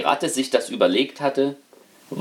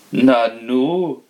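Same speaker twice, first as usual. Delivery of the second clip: a touch of astonishment; mildly taken aback; the householder mildly surprised by the rat; astonished; 3 dB louder than usual. Acted. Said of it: "sagte erstaunt".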